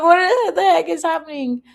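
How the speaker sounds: high, half-laughing, half-wailing bewilderment; mock despair through laughter. Host retelling her confusion over the South Africa guesses, laughing. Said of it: "I'm like".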